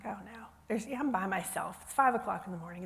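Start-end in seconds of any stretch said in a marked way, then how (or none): 0.0-0.5 soft, hushed "oh no" under the breath; quiet dread. Retold or imitated. Preacher alone at dawn hearing a dog, hushed dread. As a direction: murmur it as if afraid to be heard. Sees big scary dog.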